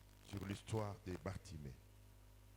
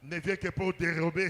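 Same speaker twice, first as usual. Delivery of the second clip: loud, higher-pitched preaching cry; pregação inflamada; 6 semitones higher, 15 dB louder than usual. Real, not acted. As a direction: declare it forcefully to the congregation. Preacher declaring prayer at full force.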